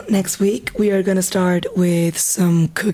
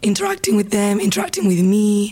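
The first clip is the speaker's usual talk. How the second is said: hoarse, half-whispered, strained voice; losing her voice. Real, not acted. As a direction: push words out through a worn-out voice. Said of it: "Sorry for my voice".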